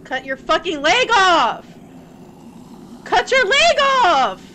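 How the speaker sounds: loud, high urgent yell; yelling at screen. Real, not acted. Player yells at the game character to cut her leg off, urgent shout. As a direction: shout the order at the character to save herself.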